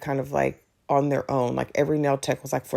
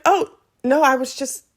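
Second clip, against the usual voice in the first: high-pitched, hasty denial; flustered backpedal; 9 semitones higher, 3 dB louder than usual. Retold or imitated. Customer caught out by nail tech's question, flustered. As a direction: jump up high to smooth it over.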